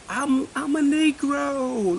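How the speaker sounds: very high, drawn-out mock announcement; hammed-up high-pitched declaration, slowly drawn out; mock proclamation. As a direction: stretch it out high, like calling to all around. Acts out announcing it while walking around.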